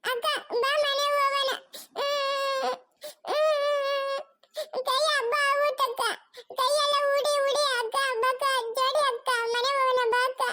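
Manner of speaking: put-on high, tearful child pleading with big sister; crying little child. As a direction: sob and whimper between short phrases. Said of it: "ಅವ್ನ್ ಅಳ್ತಾವ್ ನೋಡ್ರಿ".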